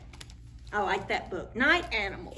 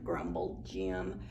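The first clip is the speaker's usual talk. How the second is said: much lower, soft; low narration; 14 semitones lower, 7 dB quieter, slower than usual. A narrator's reading. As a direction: drop it down low.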